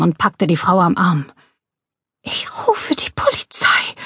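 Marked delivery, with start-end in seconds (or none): 2.2-3.9 high, faint breath of a voice; breathy, frightened. A narrator's reading. Old woman seized by an intruder, faint frightened breath. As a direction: barely breathe out the threat. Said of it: "hauchte sie".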